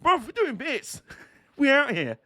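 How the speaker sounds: high-pitched imitation of a buzzing driver; mock excited driver. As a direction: imitate his excited celebration, pitched well up. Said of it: "but it's just like".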